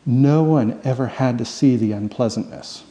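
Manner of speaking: usual speaking voice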